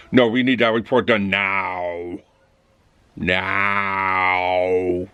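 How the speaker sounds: a hint of a slow, low robot boss; lightly voiced cold robot manager, slow and flat-out; the manager demanding the report, slow and low like a robot; robotic manager. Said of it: "Just like the possibly perfect robot".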